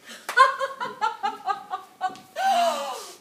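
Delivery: high-pitched theatrical cackle; high gloating laugh. Acted. Wife relishing her revenge before the man, high gloating laugh.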